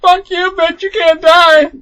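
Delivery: high, loud and slow mock fury; cursing at the sidekick in play; mock rage. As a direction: yell it with drawn-out mock outrage. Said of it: "And you're like".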